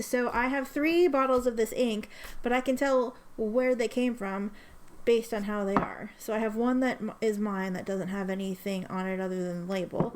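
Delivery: ordinary talk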